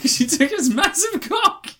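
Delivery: high, slow, laughing. Real, not acted. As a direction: squeal it out while cracking up. Cracking up at the joke.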